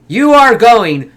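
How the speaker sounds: loud, high, slow emphatic outburst; indignant rant. Real, not acted. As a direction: hammer out each word in outrage. Ranting at the pizza eaters.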